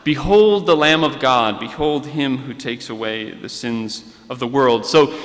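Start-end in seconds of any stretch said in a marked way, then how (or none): none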